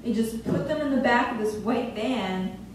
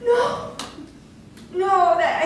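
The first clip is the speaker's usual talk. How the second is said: high, stunned protest; acted-out panic, pitched up; horrified refusal; 8 semitones higher, slower than usual. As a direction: recoil in mock horror. Acts out shock at prison term.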